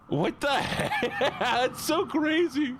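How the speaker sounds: high, drawn-out words breaking into laughter; laughing disbelief. Real, not acted. Laughing at absurd chat message.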